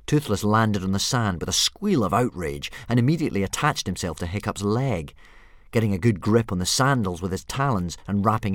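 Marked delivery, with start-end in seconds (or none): none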